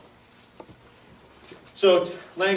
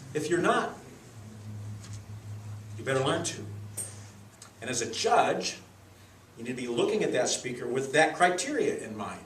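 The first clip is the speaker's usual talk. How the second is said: ordinary delivery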